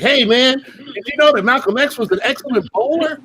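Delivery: high, eager know-it-all voice. Retold or imitated. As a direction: share trivia like an overeager colleague. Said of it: "they're like".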